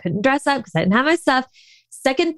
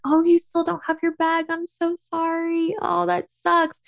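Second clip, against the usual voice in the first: sympathetic friends; 7 semitones higher than usual. Retold or imitated. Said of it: "people are like".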